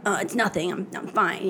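soft, understated acted sulk; mock sulky denial. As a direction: brush it off quietly, pretending all is well. Upset girl denying it, acted.

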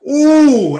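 a room gasping at a gun; startled crowd ooh; group ooh. Retold or imitated. Group reacts to seeing gun.

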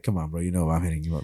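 lightly voiced smooth-talking dealer; chill dealer voice. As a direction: play the buddy dealer, low and easy. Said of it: "he's just like".

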